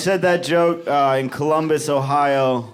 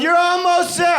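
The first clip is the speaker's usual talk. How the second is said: higher yelled "almost there", like a cheering fan; mock yell; 4 dB louder than usual. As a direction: call it out brightly at the runners. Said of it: "One guy yelled".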